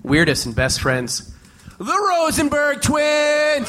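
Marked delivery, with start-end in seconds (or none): 1.8-3.7 slow, high, drawn-out host announcement; announcer intro. Acted. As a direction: sell the name like a ring announcer. Announcing the guests.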